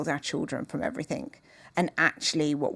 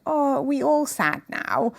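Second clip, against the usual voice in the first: put-on child's voice; 9 semitones higher than usual. Child's question voiced in story.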